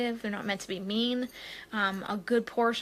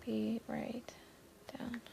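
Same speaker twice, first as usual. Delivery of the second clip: whispered reply; 12 dB quieter, slower than usual. Real, not acted. Answering someone off camera.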